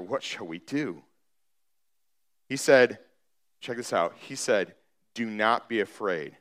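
low, softened suspenseful scripture reading; grave, subdued Bible narration; hushed storytelling. Acted. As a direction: draw them in with a low, quiet voice.

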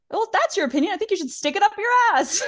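high, loud, fast retold tirade; mock angry outburst. Katie telling Raquel off in a retold fight, high mock outburst.

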